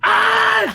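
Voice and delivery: high, loud mock scream; hammed-up angry screech; imitated scream. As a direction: let out a wild teacher's shriek. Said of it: "She just screamed really loud".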